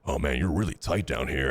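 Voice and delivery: low, heavy, serious masseur voice; deep masseur voice. Retold or imitated. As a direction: play the masseur, deep and solemn. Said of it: "And he goes".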